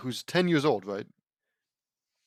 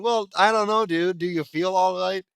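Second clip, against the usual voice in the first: voiced character; 6 semitones higher, 6 dB louder than usual. Character line read high.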